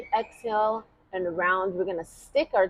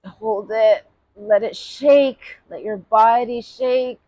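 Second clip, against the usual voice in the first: strained while tensing; 5 semitones higher, 7 dB louder than usual. Real, not acted. Tensing and holding breath.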